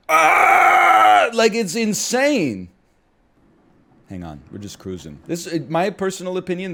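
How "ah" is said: high, loud falsetto scream; imitated rock scream. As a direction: belt out the pre-chorus yell. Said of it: "what is that scream".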